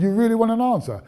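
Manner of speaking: mock-defiant quick challenge; defiant retold challenge. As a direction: dare him to hear the truth.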